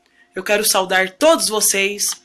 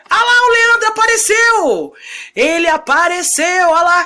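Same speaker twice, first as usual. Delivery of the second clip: excited; 8 semitones higher, 4 dB louder than usual. Real, not acted. Host finally joins the live.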